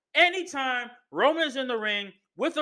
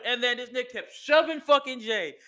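ordinary talk, nothing marked

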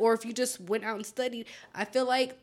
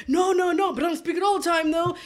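imitating classmate; 6 semitones higher than usual. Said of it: "And he was like".

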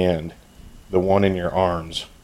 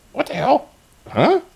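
very high, startled goblin squeak; shocked goblin; 5 dB louder than usual. Acted. Goblin startled by the question, high squeaky shock. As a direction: yelp in confusion.

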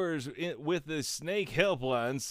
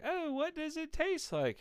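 mock curious voice; 13 semitones higher than usual. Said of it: "And you were like".

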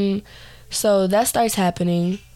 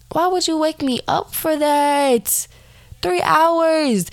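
annoyed; 8 semitones higher, slower than usual. Retold or imitated. Woken up by sister, annoyed.

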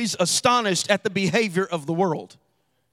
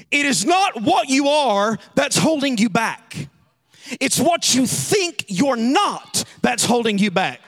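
raised, ringing pulpit voice; preaching at full force; 4 semitones higher, 4 dB louder than usual. Acted. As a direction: drive the point home to the congregation. Preaching climax.

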